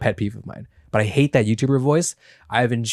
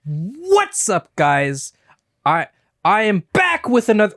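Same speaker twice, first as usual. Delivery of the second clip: high-pitched, drawn-out "What's up, guys"; accentuated YouTube voice; 7 semitones higher, slower than usual. Shown as a demonstration. Host showing the accent he hates, try-hard YouTube intro. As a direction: punch it up with mock vlogger oomph. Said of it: "Give it some oomph".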